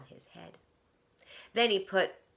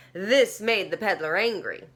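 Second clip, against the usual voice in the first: usual speaking voice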